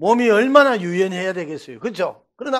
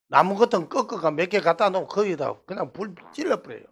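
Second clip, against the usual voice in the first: ordinary talk, nothing marked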